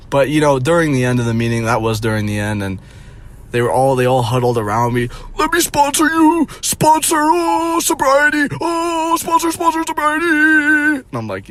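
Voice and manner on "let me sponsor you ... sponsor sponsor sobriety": shrill, sky-high mocking chant of eager sponsors; mocking AA members. Mocking the AA crowd who huddled round him, in a shrill high squeal. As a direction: squeal it like pushy cartoon do-gooders.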